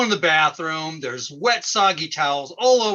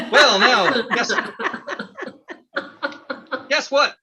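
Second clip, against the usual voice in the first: hokey farmer host; 8 semitones higher than usual. Acted. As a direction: ham it up as a cornball farmer. Plays hokey farmer host character.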